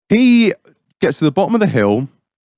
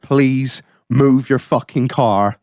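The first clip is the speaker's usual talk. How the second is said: slow menacing; much slower than usual. Retold or imitated. Retold slow final warning.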